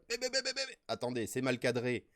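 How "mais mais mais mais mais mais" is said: high rapid stuttered protest; exasperated buts. Real, not acted. Host flustered by bad framing, high sputtering buts. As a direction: sputter at the broken screen.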